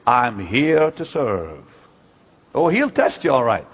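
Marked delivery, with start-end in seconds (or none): 0.1-1.6 mock pious claim. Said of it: "Do you really mean it when you say".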